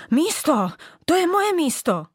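high furious huff on moje; huffing rage. Acted. Old bauble huffing at the little one over her spot. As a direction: snort out possession of the spot. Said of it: "Funěla".

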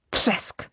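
unvoiced whispered crash sound; hushed onomatopoeia for a crash. A narrator's reading. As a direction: breathe out the crash like a sound effect. Log crashes into trunk.

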